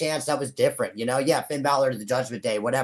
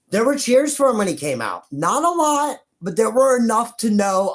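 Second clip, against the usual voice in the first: higher and louder, pushing the point hard; excited insistence; 9 semitones higher, 5 dB louder than usual. Real, not acted. A fan defending Danhausen's cheers, excitedly raised voice. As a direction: sell it like you're defending your guy.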